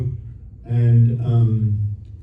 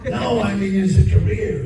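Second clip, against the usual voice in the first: insistent producer; 7 semitones higher than usual. Retold or imitated. Acted producer pressing his question.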